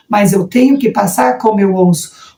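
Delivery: ordinary talk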